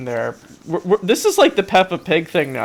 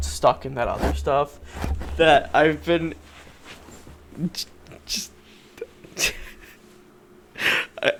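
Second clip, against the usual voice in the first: ordinary talk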